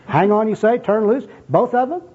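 high, rising incredulous echo; mock-puzzled repetition; baffled questioning. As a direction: query each phrase, completely confused. Bum baffled by contradictory advice.

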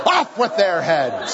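hammed-up, drawn-out tyrant's command; mock execution order. Joking about the other puppies, mock bloodthirsty decree. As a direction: pronounce doom with playful relish.

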